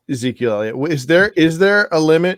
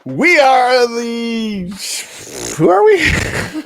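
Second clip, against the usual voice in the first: announcer voice; 7 semitones higher, much slower than usual. Pretend. Slow drawn-out show intro voice.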